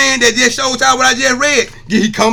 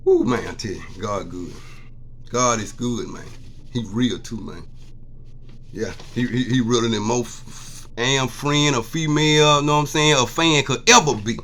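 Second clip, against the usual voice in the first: emotional; 10 semitones lower, 8 dB quieter than usual. Real, not acted. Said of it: "I got a little emotional".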